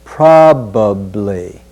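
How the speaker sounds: "Probably" slow, with every syllable of "probably" spoken; full probably. Shown as a demonstration. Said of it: "we want to say".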